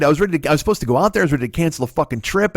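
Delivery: normal talking voice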